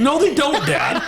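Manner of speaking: high, loud laughing objection; laughing loud protest. Retold or imitated. Telling dad off for catcalling, high loud laughing protest. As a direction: shout him down while laughing. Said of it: "I was like".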